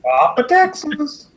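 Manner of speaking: high, slow squeaky voice; high put-on character voice; Papa Texas voice. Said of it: "you forgot to say it's me".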